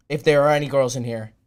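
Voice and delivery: ordinary delivery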